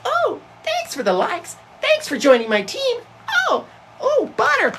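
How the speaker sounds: very high, squeaky doll voice; pink Barbie girl. Acted. Mimicking the pink Barbie girl thanking viewers, squeaky falsetto. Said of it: "And the pink Barbie girl".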